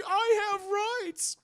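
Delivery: high, slow protest; hammed-up high, slow indignant whine. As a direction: whine it like an outraged entitled man. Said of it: "And he's like".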